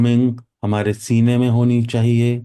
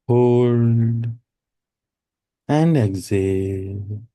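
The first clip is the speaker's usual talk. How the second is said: drawn-out words, long pauses, even tone; steady, lulling meditation instructor; guided meditation. Real, not acted. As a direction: guide each breath in and out, unhurried. Leads a breathing meditation.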